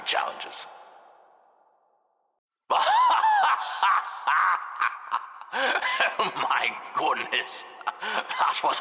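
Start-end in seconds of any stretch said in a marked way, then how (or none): 2.7-6.1 hysterical laugh. Acted. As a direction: howl with mocking delight. Laughing at player's fall.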